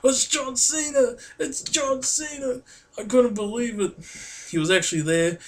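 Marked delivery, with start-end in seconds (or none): 0.0-3.9 high, drawn-out fan squeal of excitement; reliving a star-struck freak-out, pitch way up. Retold or imitated. Fan jumping on uncle after meeting wrestling hero, freaking out. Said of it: "I was still freaking out so much".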